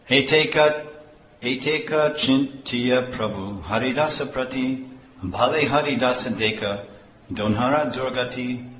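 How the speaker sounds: chanted Bengali verse reading; verse recitation. Acted. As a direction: intone the scripture verse.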